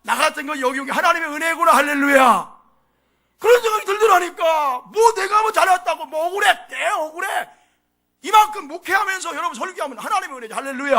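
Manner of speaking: loud, high sermon cry; full-force preaching. Acted. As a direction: drive every point home from the pulpit. (힘차게 외치는 설교)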